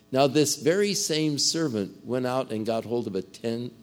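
ordinary delivery

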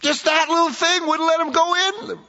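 high, incredulous voice of a stunned listener; a hearer who can't believe it, voiced high; stunned disbelief.

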